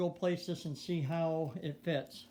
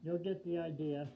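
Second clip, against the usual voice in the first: muffled behind mask; 13 dB louder than usual. Real, not acted. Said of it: "hard to hear me with the mask on".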